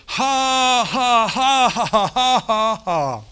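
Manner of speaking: high, shouted string of "ha"s; forced loud laugh. Retold or imitated. Man alone in his car laughing by faith, forced and shouted. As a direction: belt out a fake laugh with nothing funny. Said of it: "and I'd say".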